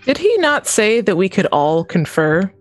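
normal talking voice

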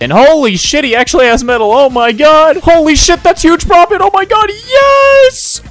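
high, loud, escalating joy; ecstatic celebration. Acted. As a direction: build to a screamed, drawn-out yes. Celebrates huge profit.

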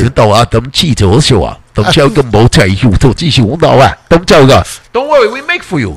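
假装说中文; fake Chinese. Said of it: "my Chinese is nasty though".

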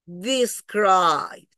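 DESCRIBED stretched slowly into two syllables, no E before the D; silent E. Shown as a demonstration. Teacher repeating the correct form for students, silent E. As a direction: let the D swallow the E in "Described". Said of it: "pero no lo pronuncio".

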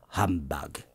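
low, sour humbug; grumpy old miser. Acted. Scrooge with no better answer, low scornful humbug. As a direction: mutter it like a cornered miser. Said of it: "followed it up with".

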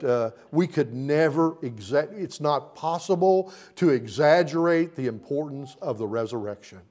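usual speaking voice

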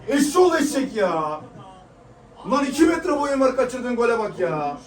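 loud, slow, raging rant; angry yelling. Real, not acted. Fan yells at the striker who missed the goal.

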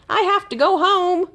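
high, bright sing-song farewell; little hamster calling to the exhibits; calling goodbye. A narrator's reading. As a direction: call out a cheerful goodbye while running. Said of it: "Fergus called".

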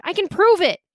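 high, urgent promise; strained insistence. A narrator's reading. Boy with knife on his windpipe, urgently offering proof. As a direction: push it out urgently, high-pitched. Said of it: "I croaked".

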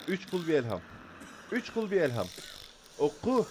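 high, loud urgent command; panicked shout. Real, not acted. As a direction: yell the prayer name in a panic.

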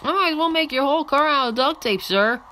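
high put-on voice to the driver; mock pit crew scolding; high mock engineer. Pit engineer scolding the driver, high mock voice.

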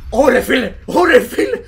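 loud, startled outburst; shocked exclamation. Real, not acted. Player shocked by something landing on them, loud exclaiming. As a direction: exclaim loudly, rattled by the scare.

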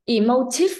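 "emotive" loud, jumping up on the last syllable; mimicked wrong stress. Teacher echoing a student's mistake, "emotive" rising at the end. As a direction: throw back "emotive" with TIVE rising sharply. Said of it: "Là nó như âm mấy mà đọc là emotive vậy".

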